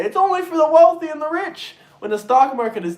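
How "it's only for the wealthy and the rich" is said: higher mocking whine; lightly voiced sneering critic; a hint of a mocking caricature; mock whiny complaint. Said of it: "you say".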